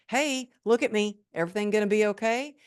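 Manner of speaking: high, loud, rushed frightened question; desperate plea. Retold or imitated. Frightened patient begging the paramedic to look at her, high and fast. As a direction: grab his attention and beg for reassurance.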